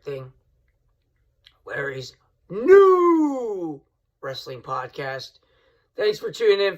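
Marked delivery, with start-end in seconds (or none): 1.7-5.4 slow, drawn-out, booming show title; announcer voice. Acted. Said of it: "show title announcement".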